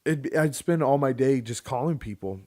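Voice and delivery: usual speaking voice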